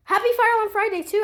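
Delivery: excited. Real, not acted. Cheerful holiday greeting to viewers.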